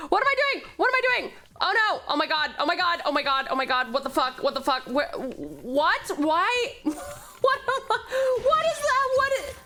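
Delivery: high-pitched, repetitive panicked babble with nervous laughs; flustered panicking. Real, not acted. Streamer lost as a game timer runs, flustered panic. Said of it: "I'm just panicking".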